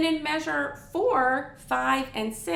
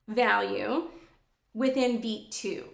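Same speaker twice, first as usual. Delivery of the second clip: ordinary talk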